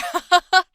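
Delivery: bright, high happy laugh; playful laugh. Acted. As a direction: laugh like the fun sidekick. Laugh closing the read.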